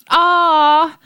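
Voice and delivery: put-on high loud childish wail. A narrator's reading. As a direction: let out a shrill crying wail. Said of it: "wailed Rosie".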